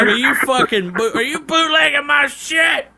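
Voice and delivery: hammed-up mobster outrage; mock furious mafia. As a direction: laugh through a fake accusation. Acted mafia confrontation.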